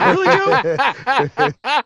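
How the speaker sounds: squeaky high laugh through the words; cracking up while asking it; laughing disbelief. Real, not acted. Laughing at the monster that wrecked the capture.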